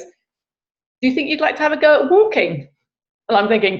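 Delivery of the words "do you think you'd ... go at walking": small, high-pitched piping voice; funny little voice. Retold or imitated. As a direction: let a high little voice pipe up. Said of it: "funny little voice comes, pipes out of me".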